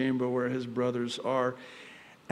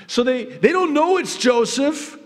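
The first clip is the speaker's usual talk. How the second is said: preaching burst; 13 semitones higher, 9 dB louder than usual. Real, not acted.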